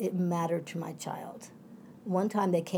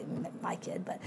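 whispered, much softer; whispered aside; 9 semitones lower, 10 dB quieter than usual. Real, not acted. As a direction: tuck it in as a quick whispered aside. Lowering voice about own child.

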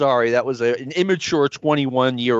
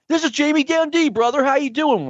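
Jamie Dundee; 12 semitones higher than usual. Retold or imitated. Prank caller introducing himself as a wrestler, high put-on voice. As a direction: put on a high-pitched phone persona for a prank. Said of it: "as soon as I say, I'm Jamie Dun".

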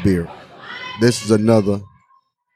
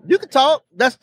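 excited; 11 semitones higher than usual. Real, not acted. Hyped invitation to the guest.

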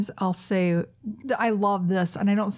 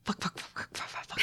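rapid, very soft string of swears; fast whispered swearing; 13 dB quieter than usual. Shown as a demonstration. Showing how she swears on the show, fast whispers. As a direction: rattle them off under your breath. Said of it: "like, really fast".

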